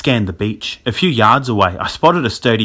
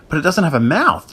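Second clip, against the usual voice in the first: high-pitched, horrified disbelief; uneasy, incredulous cry; shuddering; 6 semitones higher than usual. A narrator's reading. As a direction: object in creeped-out amazement. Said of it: "Jack shuddered".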